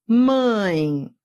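"mãe" drawn out, gliding into an I sound; som do ditongo. Shown as a demonstration. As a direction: stretch "mãe" so the final I is heard. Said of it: "se eu digo assim". ("mãe" alongado, terminando em som de I)

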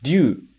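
"ryu" as one sound, ryu-u; teacher models "ryu" in one glide; contracted ryuu. Shown as a demonstration. Teacher showing dragon word: "ryu" as one contracted sound. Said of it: "o segundo que é o dragão".

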